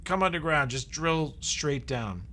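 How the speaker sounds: rally them to dig down; a lightly voiced excited ally; eager ally. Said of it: "T'Challa's like".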